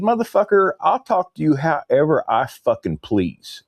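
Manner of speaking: high-pitched, indignant snap back; retold angry comeback. Customer retelling his retort to the lippy kid, indignant. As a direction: throw it back defiantly, pitched well up.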